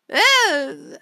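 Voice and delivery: high, loud mock "eh"; imitated half-interested reply; noncommittal. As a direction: shrug it off with a drawn-out sound. Acting a character's noncommittal reply.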